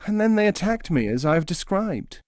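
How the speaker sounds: high plaintive bird voice; small and hurt; forlorn nightingale. Acted.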